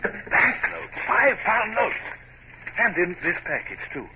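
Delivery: high-pitched surprised exclamation; astonished discovery. Acted. Hornblower finding banknotes in the chest, astonished. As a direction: gasp at finding the money.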